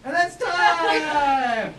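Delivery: loud, slow drawn-out call; calling time. Real, not acted. Host ends the improv scene with a long loud call. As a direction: belt it out to end the game. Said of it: "host calls time on the scene".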